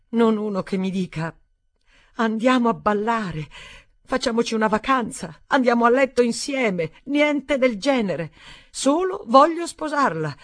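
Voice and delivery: high, loud, crying outburst building up; tearful outburst. Acted. Stephanie crying out at Daniel about proposals, high tearful outburst. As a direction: burst out after weeping silently. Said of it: "Poi sbottò".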